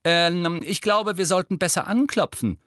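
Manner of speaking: high, loud, hesitant suggestion; a touch nervous. A narrator's reading. Hermine suggests knocking at Creature's door, slightly nervous. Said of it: "klang jetzt eine Spur nervös".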